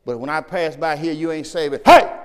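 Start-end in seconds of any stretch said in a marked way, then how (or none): 1.8-2.2 sudden loud call. Real, not acted. Preacher calling out a listener in the pews, loud. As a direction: snap a loud hey at them.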